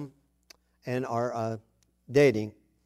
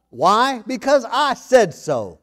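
stern dad; 6 semitones higher, 7 dB louder, much slower than usual. Retold or imitated. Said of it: "to say the darndest things".

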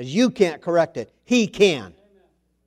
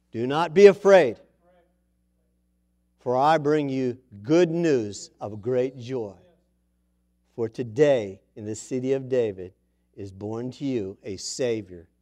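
angel's announcement; 7 semitones lower than usual. Acted.

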